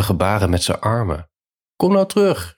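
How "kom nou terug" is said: high-pitched call across a distance. A narrator's reading. Peter calls Jacques back from the singel, high calling out. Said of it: "riep hij".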